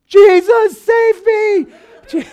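high, loud desperate scream for help; panicked yell. Retold or imitated. Pastor reliving his bike crash, screaming for help. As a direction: cry out as if crashing downhill. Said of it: "I just started yelling".

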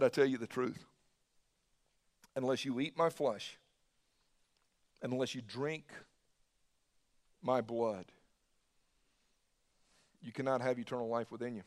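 hushed, reverent quote of Jesus; solemn scripture reading. Acted. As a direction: let the scripture land softly and gravely. Reads Jesus' words solemnly.

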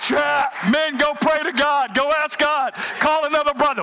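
loud, high preaching shout; full-force preaching. Acted.

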